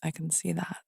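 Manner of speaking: very soft, mock-solemn murmur; hushed, hammed-up film seriousness; really serious. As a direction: drop right down for a hushed dramatic line. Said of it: "she gets really serious and goes".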